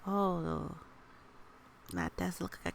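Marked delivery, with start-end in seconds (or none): none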